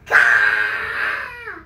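very low loud croaking caw; troll cry. Acted. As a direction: let out a deep startled squawk. Troll cries out falling.

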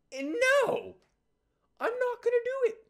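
very high, loud mock shriek; shrieked refusal. Camper refusing to sing with Zach, shrieked protest. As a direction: escalate into a squealing, hammed-up protest.

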